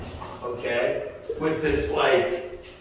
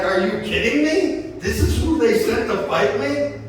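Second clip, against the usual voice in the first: high, scoffing giant voice, a touch louder; mocking giant; 9 semitones higher, 4 dB louder than usual. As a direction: sneer it like a giant who can't believe it. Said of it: "he starts laughing, he's like".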